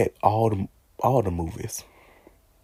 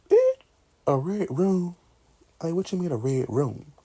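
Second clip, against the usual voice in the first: puzzled high "a red room?"; baffled by the red room; confused; 8 semitones higher, 3 dB louder than usual. Retold or imitated. Reacting to the red room, puzzled. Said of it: "I'm like".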